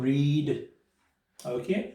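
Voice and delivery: ordinary delivery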